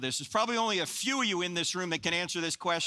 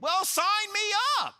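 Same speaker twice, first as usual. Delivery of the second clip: high, mock-eager sarcasm; sarcastic; 4 dB louder than usual. Speaker mocking the idea of a new religion, high mock-eager sarcasm. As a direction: pretend to jump at a bad deal. Sarcastic reply to disciples' plan.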